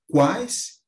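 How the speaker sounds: "quais" loud and rising, vowels through the mouth; tritongo oral. Shown as a demonstration. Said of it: "aqui o oral". ("quais" forte, som só pela boca)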